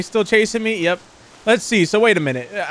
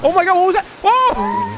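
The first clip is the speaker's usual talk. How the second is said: panicked shouting; 11 semitones higher than usual. Real, not acted. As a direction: freak out at the monster. Panicked by monster.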